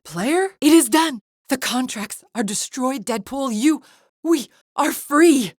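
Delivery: high-pitched, building to a drawn-out cry; elated, pitched well up and rising in force; triumphant announcement. Acted. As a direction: proclaim the liberation. Announces that the contracts are destroyed.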